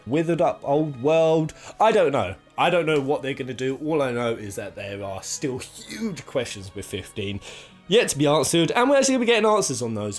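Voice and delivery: usual speaking voice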